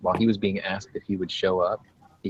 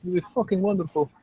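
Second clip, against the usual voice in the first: put-on Russian accent; 12 semitones higher than usual. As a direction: play a TV chef with a Russian accent. Cooking with Vladimir Russian persona.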